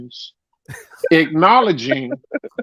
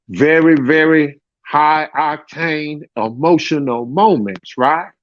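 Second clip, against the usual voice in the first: ordinary talk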